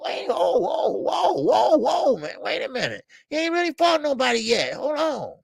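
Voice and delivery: high alarmed wait-whoa, held back; alarmed stop. Retold or imitated. To the fans pushing a fighter, high alarmed protest. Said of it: "I'm like".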